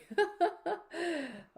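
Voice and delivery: a short high laugh after "not sorry"; cheeky laugh. Real, not acted. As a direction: laugh it off, unapologetic. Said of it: "sorry, but not sorry".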